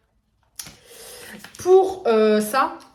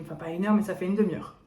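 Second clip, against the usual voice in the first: usual speaking voice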